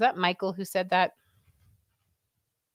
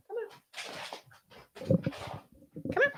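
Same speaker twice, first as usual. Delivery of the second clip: coaxing the dog; 8 dB quieter, much slower than usual. Real, not acted.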